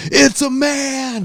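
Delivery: high, slow mock yell of it's a man; yelling across the room. Friends yelling across the room at their buddy, high mock yell. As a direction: call it out across a strip club. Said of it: "We're yelling it from across the room".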